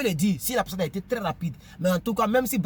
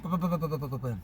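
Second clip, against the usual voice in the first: quick run of popping syllables, tailing softer; rapid "po-po-po" mumble; 4 semitones lower, 5 dB quieter, faster than usual. Real, not acted. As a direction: skip the date with po-po-po. Skips the date in the letter. (po-po-po rapide pour sauter la date)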